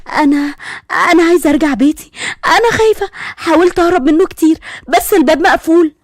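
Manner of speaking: high, halting tearful voice; crying young woman. Acted. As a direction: plead through tears like a frightened girl. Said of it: "والبنت عيطت أكتر". (صوت بنت بتعيط ومرعوبة)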